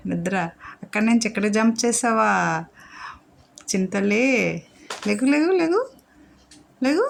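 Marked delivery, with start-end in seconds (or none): 5.1-7.0 high, sing-song repeated call; waking call. Real, not acted. Owner calling sleepy dog to get up, high sing-song. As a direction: chirp it to wake her up.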